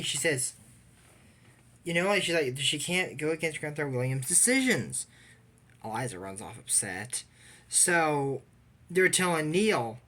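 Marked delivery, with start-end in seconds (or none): none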